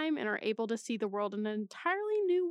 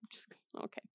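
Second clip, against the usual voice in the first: whisper; 15 dB quieter than usual. Real, not acted. Muttered aside after reading.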